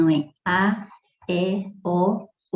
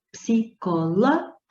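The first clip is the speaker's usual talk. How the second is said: psicológica slow, broken after a rising LÓ; stressed antepenultimate syllable; 2 semitones higher than usual. Shown as a demonstration. Teacher showing the same stress pattern, stressed LÓ in psicológica. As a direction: sound out psicológica, lifting the LÓ. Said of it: "a mesma coisa".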